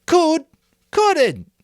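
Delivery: high, loud bickering; quarrelling shout. A narrator's reading. Boys in the assembly bickering, loud high shouts. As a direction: fling it back at him.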